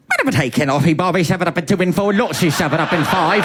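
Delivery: put-on fast, mumbled thick British regional accent; Rosie's mumbled accent. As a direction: rattle it off in an unintelligible British brogue. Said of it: "every time she talked, I didn't know".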